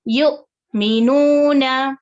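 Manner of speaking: फ़तू very slow, with a small stop before तू; tiny jolt in the middle of फ़तू; slight catch on hamza. Shown as a demonstration. Teacher shows students the stop at hamza sakina. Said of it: "थोड़ा रुक कर फिर आगे बढ़ना है".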